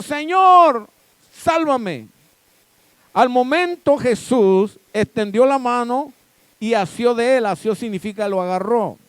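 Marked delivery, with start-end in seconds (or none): 0.0-2.1 crying out for help. A narrator's reading. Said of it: "dio voces diciendo". 3.1-7.5 loud and high, "tuvo miedo" hammered out; booming pulpit preaching, building to a cry; full-force preaching. Acted.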